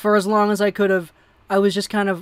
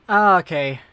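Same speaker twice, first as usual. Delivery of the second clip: ordinary talk, nothing marked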